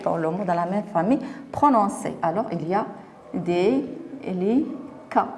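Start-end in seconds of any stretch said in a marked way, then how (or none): 3.3-5.3 very slow, separated "délicat"; syllable by syllable. Shown as a demonstration. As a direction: sound out délicat in three parts. Teacher sounds out the syllables. (délicat syllabe par syllabe, très lentement)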